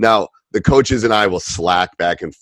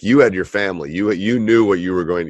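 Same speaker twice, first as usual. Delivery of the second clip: usual speaking voice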